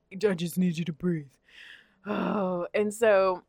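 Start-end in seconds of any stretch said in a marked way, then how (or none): 0.1-1.3 softer mock imitation of husband; lightly mocked calm voice. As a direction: parody his soothing tone. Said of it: "done with him saying".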